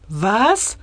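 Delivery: curious questioning rise; lightly acted puzzled query. A narrator's reading. Chancellery chief querying a colleague's odd proposal, curious. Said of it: "fragte die Kanzleramtschefin und zog neugierig".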